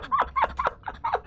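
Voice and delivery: very high, gleeful cackle; real high laughter; high-pitched laugh. Real, not acted. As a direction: laugh high after a win. Laughs after a win.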